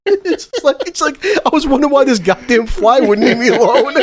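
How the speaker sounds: words broken up by high laughter; laughing while talking. Real, not acted. Telling his podcast cohost about the prank, laughing hard. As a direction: laugh your way through the line.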